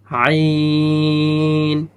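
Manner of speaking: আইন held long over four alifs; long drawn sound. Shown as a demonstration. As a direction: stretch আইন slowly and evenly. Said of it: "এখানে চার আলিফ টান হবে". (আইন চার আলিফ লম্বা টেনে)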